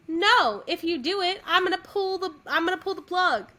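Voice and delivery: high, loud mock threat; emphatic refusal. Retelling her veto of the singer, emphatic.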